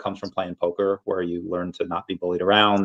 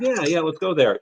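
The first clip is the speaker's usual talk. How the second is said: higher-pitched mock eager agreement; agreeable girlfriend; 8 semitones higher than usual. Friend agreeing on where to eat, mock agreeable. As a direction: play a girlfriend readily going along. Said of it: "A lot of girls will be like".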